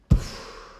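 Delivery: sharp, loud huff of breath; frustrated sigh. Real, not acted. Streamer fed up with Afterpay's currency rules, frustrated sigh. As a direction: blow out an exasperated breath.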